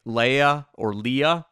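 first Leia as "LAY-a", second Leia as "LEE-a"; two pronunciations contrasted. Shown as a demonstration. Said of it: "It's kinda like the old".

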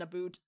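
"a boot", softly, with oo for "about"; Canadian "about". Retold or imitated. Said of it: "all I could hear was fucking".

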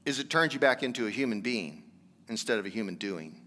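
usual speaking voice

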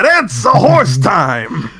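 high, slow, crying with laughter; laughing squeal. Real, not acted.

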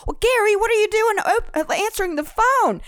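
high, teasing reproach; high mock scolding. Caller teasing the groom for answering, high mock scold. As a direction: scold him in a squeaky playful voice. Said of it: "I was like".

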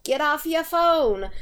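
passive-aggressive. Retold or imitated. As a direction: nag them like a tired usher. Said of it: "very passive aggressive about their".

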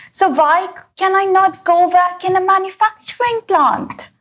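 ordinary talk

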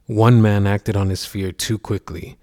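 usual speaking voice

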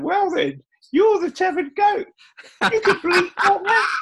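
put-on Sarah naming him the goat, high Essex accent. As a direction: deliver the punchline in a squeaky Essex accent. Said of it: "Sarah's got an Essex accent".

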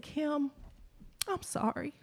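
high, soft voice on the edge of tears; real tears breaking through the apology; choking up. Real, not acted. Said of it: "I'm probably gonna cry about that".

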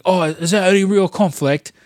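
put-on a touch of an Australian accent on the question; a hint of an Australian Gordon Ramsay; accidental Australian. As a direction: chef asking with a slight Australian lilt. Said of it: "that sounds a little bit more Australian".

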